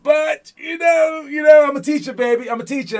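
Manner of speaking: loud, high, gleeful bragging; crowing about being a great teacher; excited boast. Real, not acted. Coach boasting to his players, loud and gleeful. Bragging after the harsh story.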